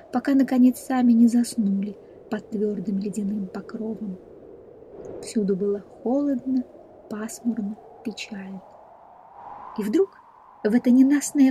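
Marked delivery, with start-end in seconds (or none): none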